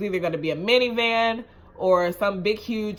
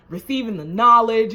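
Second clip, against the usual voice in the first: ordinary delivery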